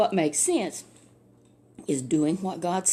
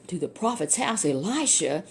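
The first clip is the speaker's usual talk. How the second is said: plain reading voice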